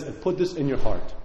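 ordinary delivery

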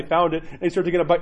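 usual speaking voice

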